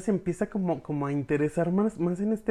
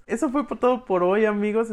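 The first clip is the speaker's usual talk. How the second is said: bright, louder, higher send-off; excited sign-off; 7 semitones higher, 7 dB louder than usual. Real, not acted. Host signing off to her listeners, excited and loud. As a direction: burst into an energetic goodbye to the audience.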